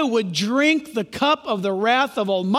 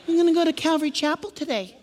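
quick, light put-on voice; playful mock-innocent announcement; chirpy churchgoer; 3 semitones higher, faster than usual. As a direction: imitate a carefree churchgoer, quickly. Mimics a churchgoer announcing plans.

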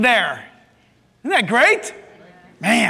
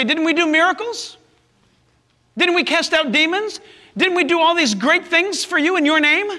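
higher-pitched, insistent questions; protesting plea; 6 semitones higher than usual. Acted. Preacher voices the many on the last day, protesting. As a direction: plead your case, each question pitched high. Acts rejected people protesting to Jesus.

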